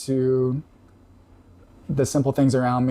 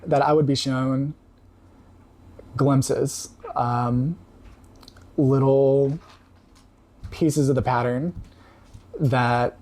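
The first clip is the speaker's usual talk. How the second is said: tearing up; slower than usual. Real, not acted. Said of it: "I don't know why I'm tearing up".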